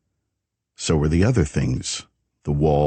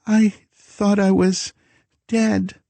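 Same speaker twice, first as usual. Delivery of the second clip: scared high voice; slower than usual. A narrator's reading. Frightened woman to shadow man.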